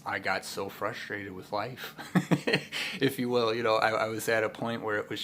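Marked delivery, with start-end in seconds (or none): 2.0-2.9 short breathy laugh. Real, not acted. Laughing at frustration.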